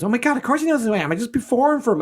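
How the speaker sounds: excited, high and quick imitation. Singer insists the royal knows her, excitedly. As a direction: rush it out high, mock star-struck. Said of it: "Mary J Blige was really excited".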